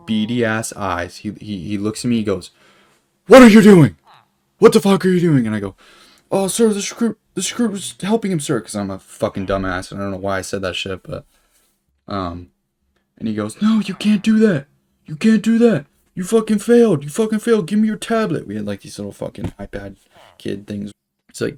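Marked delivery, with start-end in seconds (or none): 3.3-5.4 screaming, much higher and louder; full-volume shouted demand; drill instructor yell. Retold or imitated. Drill instructor yelling, retold. 6.3-8.6 high, loud sheepish recruit voice; loud timid recruit reply; nervous recruit. Retold or imitated. Recruit answering drill instructor. 13.6-18.5 screaming, much higher and louder; furious drill instructor shout; drill instructor yell. Retold or imitated. Drill instructor yelling, retold.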